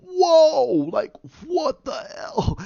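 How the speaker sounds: a startled cry of disbelief; shocked yell. Retold or imitated. A reader shocked as Zeus appears, yelling high.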